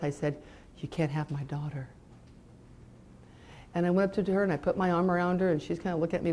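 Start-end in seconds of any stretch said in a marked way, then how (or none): 0.8-1.9 low, soft, determined vow; under her breath. Retold or imitated. Mother vowing against the enemy, softly under her breath. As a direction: say it quietly, jaw set. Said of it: "under my breath".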